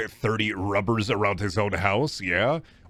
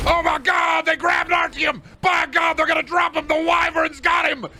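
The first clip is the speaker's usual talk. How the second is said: very high, loud hysterical play-by-play shout; screaming commentator; 5 dB louder than usual. Pretend. Streamer screaming wrestling-style commentary at the game, frantic. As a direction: scream it like the match just broke.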